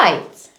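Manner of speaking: Light said as one smooth blended word. Shown as a demonstration. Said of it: "blend it".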